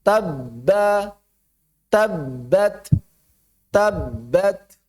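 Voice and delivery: "tabbat" split by a silent hold on the b; correct cut sound. Shown as a demonstration.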